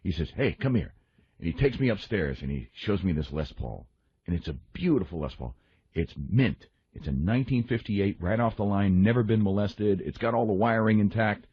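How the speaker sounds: normal talking voice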